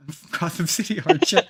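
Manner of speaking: light mock Irish lilt; echoing Irish accent. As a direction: repeat the Irish Batman line for a laugh. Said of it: "I don't know why he's Irish".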